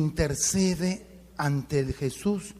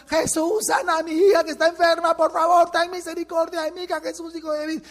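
much higher, louder pleading cry; desperate crying out; 11 semitones higher, 5 dB louder than usual. Acted. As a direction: beg loudly and keep calling. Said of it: "La mujer seguía gritando". (mujer cananea suplicando a gritos por su hija)

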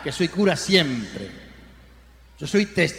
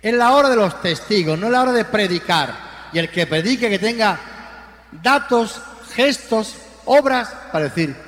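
louder, higher, ringing from the pulpit; full-force preaching; 4 semitones higher, 4 dB louder than usual. Acted. Priest to his congregation, rousing full-force preaching. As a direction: proclaim it to the whole church.

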